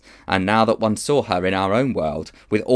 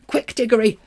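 urgent; 14 semitones higher, 4 dB louder than usual. Acted. Polly urging.

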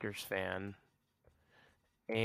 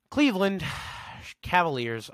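higher, louder, announced like a team introduction; sports announcer; 8 semitones higher, 4 dB louder than usual. Shown as a demonstration. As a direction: call out the team name like an arena announcer.

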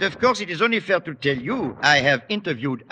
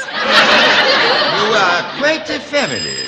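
amused surprise; 8 semitones higher, 7 dB louder, much slower than usual. Acted. Frenchman's amused exclamation.

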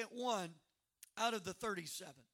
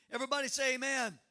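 loud, quick call; rally the room; call to the church; 5 semitones higher, 9 dB louder, faster than usual. Real, not acted. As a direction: pastor calling for amen. Calling congregation to respond.

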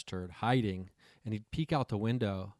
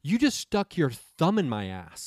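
mock outraged; 5 semitones higher, 4 dB louder, slower than usual. [name] accuses the woman.

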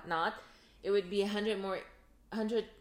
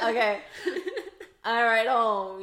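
loud happy relief; 3 semitones higher, 10 dB louder than usual. Retold or imitated. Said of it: "You know, like".

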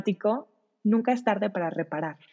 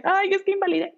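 mock distressed mother, higher; lightly hammed-up anguished mom; guilty mom; 11 semitones higher than usual. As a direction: play the mom who feels she failed. Mother voicing guilty self-talk.

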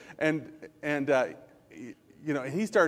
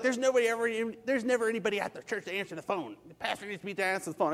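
hurried, slightly higher grumble; mock complaining; 4 semitones higher, 3 dB quieter, faster than usual. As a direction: imitate a churchgoer rattling off a complaint. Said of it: "But people were like".

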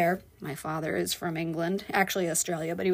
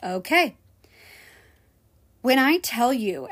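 ordinary talk

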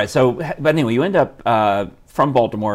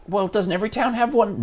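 lightly voiced puzzled local, pitched up; naive local; 10 semitones higher than usual. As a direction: put on a hint of a clueless questioner, higher. Voicing a naive local's question.

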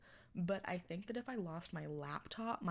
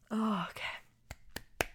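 whisper; 2 semitones higher, 4 dB louder, much slower than usual. Real, not acted. Nervous before restarting intro.

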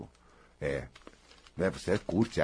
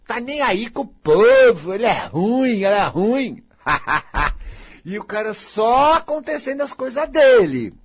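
carefree swagger; 8 semitones higher, 7 dB louder than usual. Retold or imitated. Mimics the carefree man's attitude.

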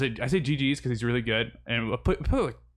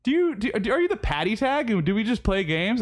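higher, keen imitation of a messaging fan; mock eager fan; 9 semitones higher than usual. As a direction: play the excited stranger asking, pitched up. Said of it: "And says".

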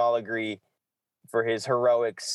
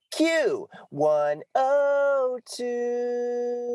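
much higher, hugely drawn-out jingle call; radio station ID voice; much slower than usual. Pretend. Host dreaming of voiceover work, doing a drawn-out station ID. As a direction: stretch it like a radio station ident. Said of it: "the guys that does".

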